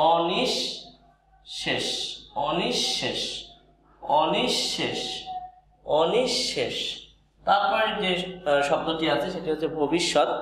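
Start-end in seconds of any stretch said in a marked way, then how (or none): 0.1-6.8 অনিঃশেষ drawn out slowly as o-nish-shesh, the শ doubled; careful slow pronunciation of অনিঃশেষ; onishshesh. Shown as a demonstration. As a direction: say অনিঃশেষ with a held double sh after the নি. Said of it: "তাহলে অনিশ".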